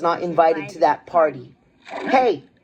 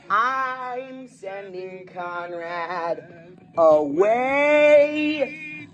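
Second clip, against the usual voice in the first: very slow, stretched syllables; long, slow-motion delivery; drawn-out words; much slower than usual. Acted. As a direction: stretch every word out long. Drawn-out words during the ride.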